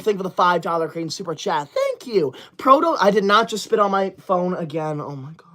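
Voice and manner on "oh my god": breathy whispered aside; embarrassed whisper. Real, not acted. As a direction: whisper it to yourself. Spit on phone, embarrassed.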